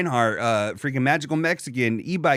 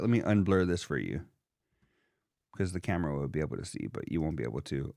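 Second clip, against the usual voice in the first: murmured aside, much softer; quiet aside; 11 semitones lower, 10 dB quieter than usual. Real, not acted. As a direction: drop to a low murmur. Aside while unblurring screen.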